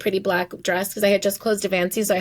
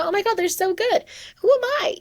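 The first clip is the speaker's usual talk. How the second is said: much higher-pitched mock awe; high, acted-out self-doubting exclamation; awed self-doubt; 11 semitones higher than usual. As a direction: play up being intimidated, pitch leaping up. Acting out her awed self-doubt.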